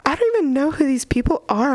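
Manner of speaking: put-on sassy Valley Girl reading of the heroine; Valley Girl accent. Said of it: "she has a Valley Girl accent".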